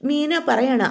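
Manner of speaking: ordinary delivery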